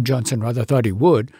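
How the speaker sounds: ordinary delivery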